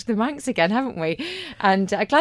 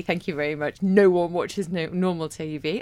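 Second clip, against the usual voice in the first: usual speaking voice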